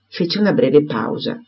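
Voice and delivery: usual speaking voice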